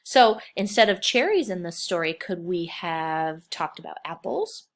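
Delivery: usual speaking voice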